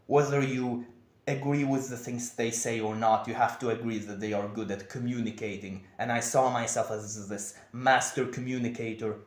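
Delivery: ordinary delivery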